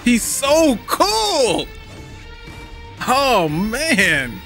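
high, slow, drawn-out gushing; real excited squeal of admiration; awed fan gushing. Real, not acted. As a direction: stretch the words out high in delight. Fan gushing at trailer character.

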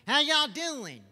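ventriloquist dummy calls hi; puppet Sam voice. Acted. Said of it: "Sam, why don't you say hey".

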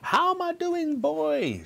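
put-on very high, squawking old-woman holler; grandma shouting. A narrator's reading. Grandma on the roof to George, shouting. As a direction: call down in a piercing granny voice. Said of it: "she shouted".

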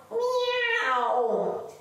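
high, drawn-out meow; playful kitten meow for the kids; kitty meow. Pretend. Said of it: "let's go into cat pose".

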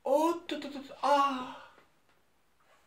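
high, teetering yelp; losing your footing mid-air; startled wobbling cry. Acted. Acrobat loses balance, falls.

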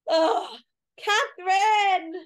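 real crying, high, loud and slow; tearful cry. Real, not acted. Mourning Catherine on the podcast, crying out her name. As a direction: break down calling out her name. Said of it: "you were tearing up there".

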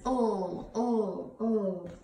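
"Oh" as a held l sound; letter sound demo. Shown as a demonstration. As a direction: sound out a drawn-out "ull" for L. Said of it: "Lizzie Lizard says".

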